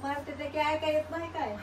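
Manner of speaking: normal talking voice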